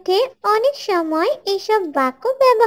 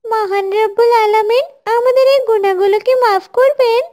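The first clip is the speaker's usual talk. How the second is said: loud, higher-pitched dismay; acted alarm; 5 semitones higher, 4 dB louder than usual. Classmates dismayed to learn they have been sinning, alarmed. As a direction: exclaim in worried remorse. Children alarmed at their own sins.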